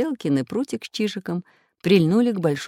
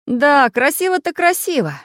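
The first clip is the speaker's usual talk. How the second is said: higher, louder grudging agreement; grudging, wincing; 11 semitones higher, 6 dB louder than usual. A narrator's reading. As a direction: agree with a wince. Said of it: "поморщился Самоделкин". (нехотя, морщась)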